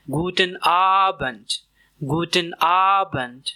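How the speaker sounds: गुडन आबंड slowly, stressed आबंड; slow drill Guten Abend. Shown as a demonstration. Teacher drilling गुडन आबंड slowly. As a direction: model आबंड slow and open. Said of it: "Repeat after me".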